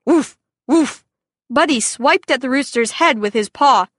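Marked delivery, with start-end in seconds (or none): 0.1-1.0 imitating a dog's woof. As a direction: woof like a dog on guard. Dog attacks stuck rooster.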